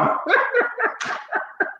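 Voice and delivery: breathy high-pitched burst of laughter; real laugh at own joke. Real, not acted. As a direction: let out an airy, squealing laugh.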